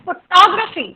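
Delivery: Photography as fuh-TOG-ruh-fee; second-syllable stress. Shown as a demonstration. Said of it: "stress कहाँ चला गया". (Photography में टॉ पर ज़ोर)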